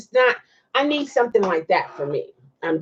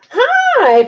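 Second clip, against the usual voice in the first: excited hi; 6 dB louder than usual. Real, not acted. Greeting a viewer from Korea.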